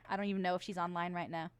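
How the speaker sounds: ordinary talk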